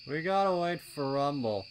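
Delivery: lounging colt laying down the rule; lazy colt voice. A narrator's reading. Snails telling the younger colts to wait, high and loud. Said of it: "drawled Snails".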